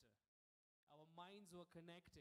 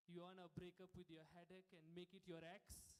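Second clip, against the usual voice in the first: usual speaking voice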